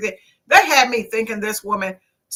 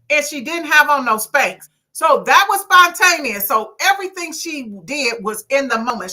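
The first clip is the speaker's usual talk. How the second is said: ordinary delivery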